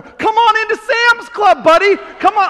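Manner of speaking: loud high taunting dare; taunting call. Retold or imitated. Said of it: "I'm literally motioning him in".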